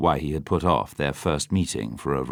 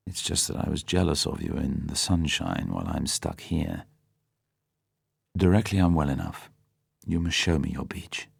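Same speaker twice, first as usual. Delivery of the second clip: softer and lower, relenting; tender; 4 semitones lower, 5 dB quieter than usual. A narrator's reading. Bond relenting to tearful Vesper, tender and soft. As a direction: gently make amends to her. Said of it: "Bond looked at her tenderly".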